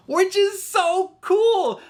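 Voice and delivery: very high, slow, squealing "which is so cool" with a falling end; excited squeal. Real, not acted. Fan gushing to viewers about the hybrid characters, high excited squeal.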